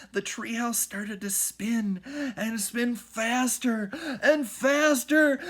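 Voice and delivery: slow, rising to a loud high shout; building excitement. A narrator's reading. Narration building with the spin.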